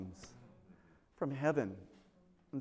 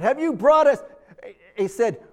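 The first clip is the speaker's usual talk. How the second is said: mock indignant complaint; 5 semitones higher, 7 dB louder than usual. Israelites complain to Moses.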